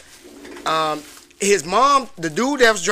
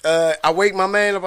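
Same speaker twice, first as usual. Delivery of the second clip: usual speaking voice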